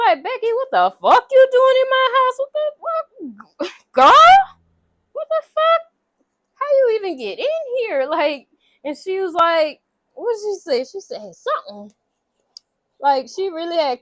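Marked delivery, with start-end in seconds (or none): 0.2-8.1 high, loud, incredulous yelling; shocked outburst. Retold or imitated. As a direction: act out finding an intruder in your house. Retold finding an intruder in kitchen.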